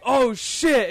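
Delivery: stoned friend noticing they overshot the map; mock surprise. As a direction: caught off guard. Stoned friend realizing mistake.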